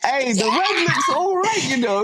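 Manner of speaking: high, slow hammed-up Italian accent; mock Italian voice. Joking about Ferrari's red in a hammed-up Italian accent. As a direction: ham up a cartoon Italian accent.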